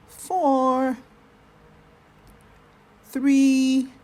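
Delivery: slow, loud count down with long pauses. Shown as a demonstration. Coach counting the held breath, slow and steady. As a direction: count each number out slowly. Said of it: "a count of eight to breathe out".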